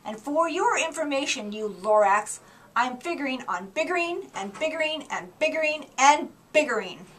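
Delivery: loud, defiant rant; mad. Acted. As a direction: push back hard and loud. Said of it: "I got terribly mad".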